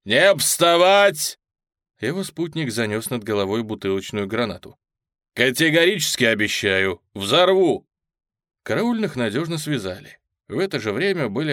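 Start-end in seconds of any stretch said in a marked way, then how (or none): 0.1-1.4 loud, slow barked order; sharp shout. Acted. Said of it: "резко крикнул". 5.3-7.9 loud, rising grenade threat. Acted.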